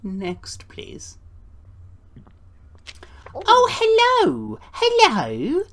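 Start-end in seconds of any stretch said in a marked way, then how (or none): 3.3-5.6 very high, slow, louder sing-song greeting; cheery visitor. Acted. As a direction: greet like a sweet old lady.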